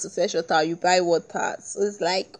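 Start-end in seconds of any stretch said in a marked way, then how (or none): none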